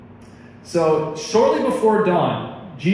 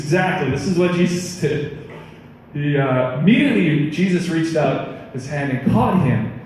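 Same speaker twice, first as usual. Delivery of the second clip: ordinary delivery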